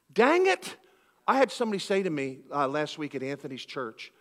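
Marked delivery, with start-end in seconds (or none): 0.1-0.8 Southern exclamation. Retold or imitated. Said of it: "you said 'dang it.'".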